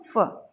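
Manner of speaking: फ as a short fuh; weak form. Shown as a demonstration. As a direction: say फ briefly, r silent. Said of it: "तो हमें क्या पढ़ना है".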